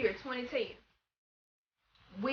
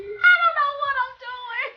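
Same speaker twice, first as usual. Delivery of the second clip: much higher, louder mock distress; mock panic; 4 dB louder than usual. Vlogger joking she is lost, high mock panic. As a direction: squeal it like a lost cartoon. Joke aside after title.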